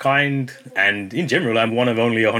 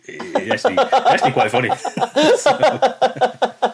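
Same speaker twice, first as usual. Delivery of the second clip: laughing; 14 semitones higher, 5 dB louder, slower than usual. Real, not acted. Laughing about abuse comments.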